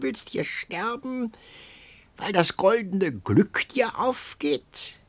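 high whispery crone voice, sly and coaxing; eerie old crone. A narrator's reading. Old beggar woman tempting the starving youth, high and whispery. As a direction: coax him like an uncanny old hag.

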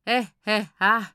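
lautes, gespieltes höhnisches Lachen; bitter auflachen, Silbe für Silbe; bitteres Gackern. A narrator's reading. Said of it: "bitteres Lachen von sich". (bitteres, gackerndes Lachen)

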